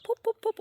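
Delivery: high peeping "poop" calls; sparrow chirps. Retold or imitated. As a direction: mimic the baby sparrows. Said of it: "going like".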